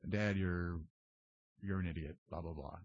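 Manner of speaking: low, quiet, half-hearted mock insult; lame, unimpassioned. Reenacting a first lame attempt at telling off dad, low and half-hearted. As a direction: imitate a feeble attempt at venting, trailing away softly. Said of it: "it was about that impassioned".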